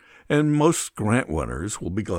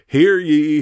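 high, loud town crier call; mock royal decree; 5 semitones higher, 6 dB louder than usual. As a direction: call it out like a herald. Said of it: "So they say to the states".

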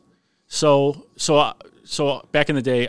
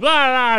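sudden high whoop from the crowd; mock excited yell; 12 semitones higher, 6 dB louder than usual. A firefighter hamming for the camera, high mock whoop. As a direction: yell like a fan who wants the camera. Guy in crowd waving hat.

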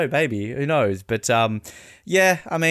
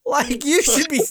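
mock outrage; 12 semitones higher, 4 dB louder than usual. Scolding Lazenby in mock outrage.

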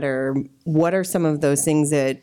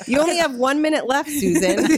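teasing timekeeper; 10 semitones higher than usual. Pretend. Teasing therapist warning.

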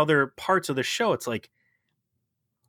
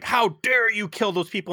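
higher-pitched, half-acted outrage; mock angry snap; 9 semitones higher than usual. Retelling Picard snapping at the elf, mock outrage. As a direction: imitate him snapping, pitch up. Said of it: "he snaps on him".

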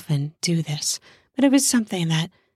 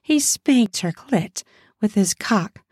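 ordinary delivery, nothing marked

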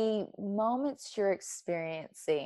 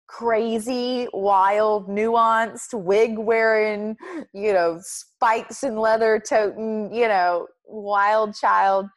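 louder, building proud list; proud declaration; 3 semitones higher, 8 dB louder, much slower than usual. Real, not acted. Telling the host who she is, loud and proud. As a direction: belt out who you are with pride.

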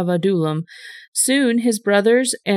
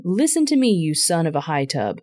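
ordinary delivery

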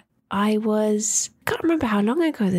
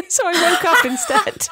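laughing; 8 semitones higher, 5 dB louder than usual. Real, not acted. Laughing while telling.

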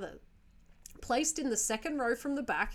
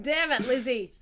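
loud mock scolding; mock exasperation; 2 semitones higher, 5 dB louder than usual. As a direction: playfully curse a clumsy colleague, raising the voice. Mock scolding of imaginary employee.

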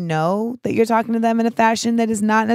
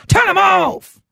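high, loud burst; exclaiming; 11 semitones higher, 5 dB louder, slower than usual. Retold or imitated. Telling listeners how she shut people out, exclaimed loudly. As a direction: snap the order out with sudden force.

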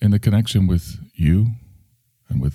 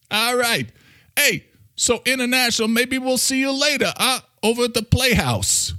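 high New York character signing off; brash New Yorker, casually inviting; Mister Coffee. Acted. New Yorker saying goodbye to International, high and brash.

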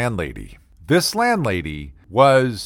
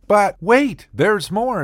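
a hint of a TV pitchman, raised high; infomercial pitch; 9 semitones higher, slower than usual. Comic telling landlady story, lightly mock infomercial. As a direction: tease it like an ad announcer. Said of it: "as they say".

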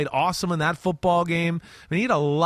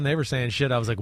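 usual speaking voice

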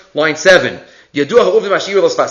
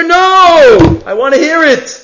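emphatic no; 10 semitones higher, 5 dB louder, slower than usual. Retold or imitated. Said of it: "The Sfas Emes says".

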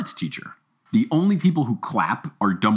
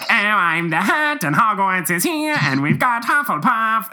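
mock Jim Dale; 8 semitones higher than usual. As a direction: mimic a sing-song audiobook reader. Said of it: "his singing voice was really annoying".

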